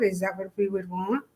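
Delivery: ordinary talk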